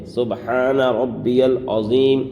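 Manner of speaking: slow سبحان ربی العظیم with every letter clear; سبحان ربی العظیم with the ha in سبحان sounded; correct recitation. Shown as a demonstration. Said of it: "یہ صحیح تلفظ ہے".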